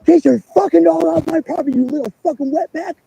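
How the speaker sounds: loud, high mock yell of the hunter; imitating a furious trespasser yelling; retold angry yell. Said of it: "And he goes".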